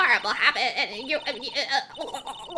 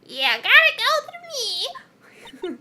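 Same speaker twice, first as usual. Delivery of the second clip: high, slow, drawn-out crone; old hag voice; 12 semitones higher, 5 dB louder, much slower than usual. Acted. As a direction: stretch it out like a creaky old hag. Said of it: "how do I do my old hag voice?".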